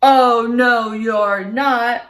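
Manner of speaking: loud troll thunder; troll refusing the biggest goat, thundering. Acted. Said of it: "thundered the troll".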